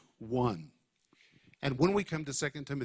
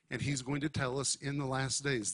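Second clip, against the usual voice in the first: normal talking voice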